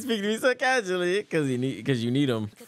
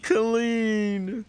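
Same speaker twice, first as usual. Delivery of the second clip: ordinary talk, nothing marked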